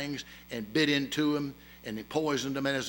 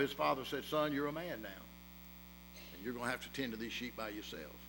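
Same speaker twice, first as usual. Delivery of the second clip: hushed, intimate story voice; soft sermon illustration told low; quiet storytelling; 5 semitones lower, 8 dB quieter than usual. A narrator's reading. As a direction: draw them into the tale softly. Quiet story of boy and snake.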